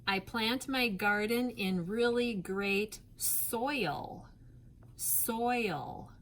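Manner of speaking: teacher dictating a practice sentence; slow dictation sentence. Shown as a demonstration. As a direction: read slowly for spelling practice. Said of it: "How about this?".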